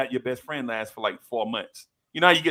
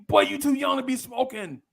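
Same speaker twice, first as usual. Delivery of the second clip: much higher, chiding tone; mock scolding teacher; 10 semitones higher than usual.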